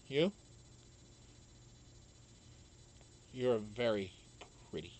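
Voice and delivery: low, slurred and dopey; slurred drunk compliment. Retold or imitated. A lightly voiced dopey slur to the nurse, low. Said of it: "And I said".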